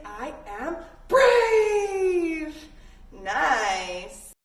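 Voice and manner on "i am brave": loud, high, very slow shout; shouted affirmation. Shown as a demonstration. Yoga teacher leading kids in a bold shout. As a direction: belt it out like a battle cry. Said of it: "and say".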